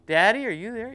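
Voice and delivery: put-on a child checking that mommy is near; little girl's voice. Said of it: "this little voice".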